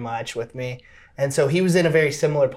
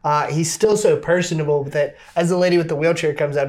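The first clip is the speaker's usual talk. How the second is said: normal talking voice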